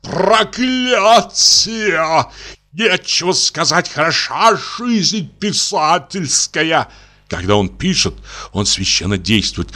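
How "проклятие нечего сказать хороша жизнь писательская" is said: high, slow, put-on outburst of a tormented writer; chest-voiced exasperation. A narrator's reading. Writer clutching his hair, cursing a writer's life, slow theatrical lament. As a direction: groan it out like a suffering author. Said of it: "грудным голосом скажет".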